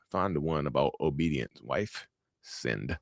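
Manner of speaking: low, soft muttering to oneself; scheming scripture-picker. Acted. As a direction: mutter it while picking what to send. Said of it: "That's how I would go right there".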